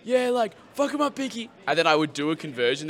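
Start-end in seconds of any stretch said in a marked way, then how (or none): 0.0-1.5 high, sneering crowd chant; high mock crowd taunt; crowd jeer. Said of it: "they're like".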